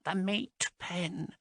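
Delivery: high, slow, soft dread; grim fearful reply. A narrator's reading. Marast telling Haas of the meat pen, soft and slow. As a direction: say it with a wince.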